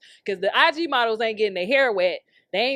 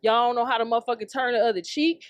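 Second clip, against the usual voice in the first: usual speaking voice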